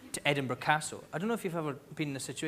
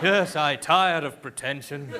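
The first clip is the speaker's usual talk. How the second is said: slow, loud, mock-grand voice; pompous; 6 dB louder, slower than usual. Comedian on Opera House stage, mock pompous. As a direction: draw it out with hammed-up pretension.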